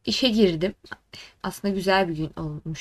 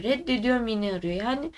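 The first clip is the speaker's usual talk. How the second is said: usual speaking voice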